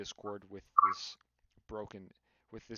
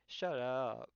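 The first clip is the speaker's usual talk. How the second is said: higher, drawn-out mock SHUT UP; mocking imitation; 4 semitones higher than usual. Someone imitating a friend to tease him, drawn-out mockery. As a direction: mimic him, stretching the words. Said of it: "someone started mocking him and imitating him".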